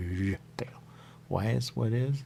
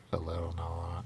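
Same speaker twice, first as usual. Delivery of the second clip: whispered; 3 dB quieter than usual. Real, not acted. Quiet confession about being high.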